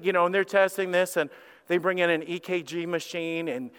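normal talking voice

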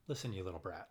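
low, soft menace; a quiet warning to a naughty kid; mock scolding. Voicing scolding adult with kids.

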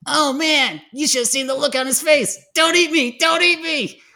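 put-on high teasing boy voice, loud; high goofy guy voice. Roger voiced gloating.

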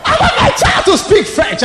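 loud, high hammed-up shout; mock parental demand. Mocking the parent, now shouted.

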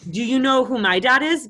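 mocking comeback. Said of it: "And I go".

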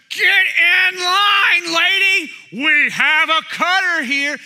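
loud, high crowd-rousing holler; indignant yelling. Retold or imitated. Angry older man at the lady who cut in line, yelling. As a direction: call out the cutter for everyone to hear. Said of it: "he's yelling".

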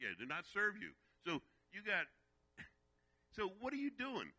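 preacher rising to full force; fervent preaching. Acted. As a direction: press the congregation with urgency.

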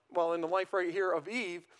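ordinary delivery

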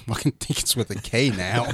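ordinary talk

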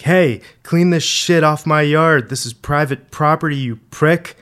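loud, high-pitched yell at someone on the lawn; angry yell. A narrator's reading. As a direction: yell it furiously, drawing out the opening. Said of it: "He yelled something at the kid".

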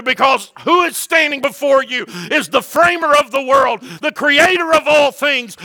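loud, high, driving sermon cry; preaching at full force. Acted. Pastor preaching to his church, loud and high. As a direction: preach it hard to the congregation.

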